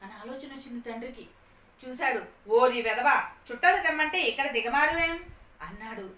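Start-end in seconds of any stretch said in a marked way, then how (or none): 2.5-5.2 loud, raised-pitch telling-off; acted fatherly scolding at full volume. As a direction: scold the boy loudly as his exasperated father. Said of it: "అని చెప్పి తిడుతున్నాడు నాన్న".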